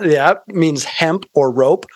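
usual speaking voice

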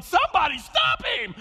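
high, loud panicked shout; yelling. Retold or imitated. Pastor acting the Cal coach screaming, high loud shout. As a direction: holler for help from the sideline. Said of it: "coach is yelling".

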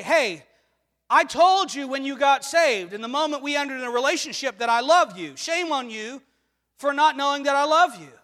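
loud, indignant mock telling-off; scolding. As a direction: scold them hard, as if they should know better. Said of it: "God doesn't scold us and say".

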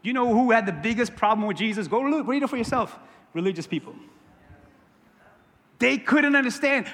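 normal talking voice

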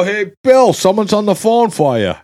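re-enacted shout to someone in another room; calling out. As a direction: holler for your brother to come to the phone. Calling his brother to the phone.

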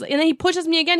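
ordinary delivery

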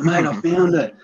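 imitating a mate shouting his find; excited mate. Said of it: "He's like".

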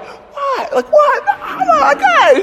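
very high-pitched, incredulous objection; mock defensive protest. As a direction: act out a sore winner protesting from afar. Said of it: "gets defensive".

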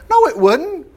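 high protest; sharp high retort. Real, not acted. Preacher rebutting an objection, high and sharp. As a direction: jump up in pitch to object.

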